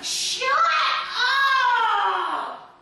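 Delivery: long, loud, high "Shut up!"; fed up with judgmental people, shouting; exasperated shout. Retold or imitated. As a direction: blast it at the critics. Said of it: "I just wanna go".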